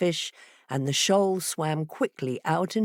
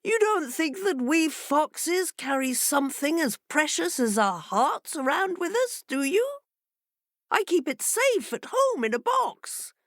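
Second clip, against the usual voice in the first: much higher, sly fox voice; fox explaining; 10 semitones higher than usual. A narrator's reading. Fox fooling the fishes, sly. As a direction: explain it with mock patience.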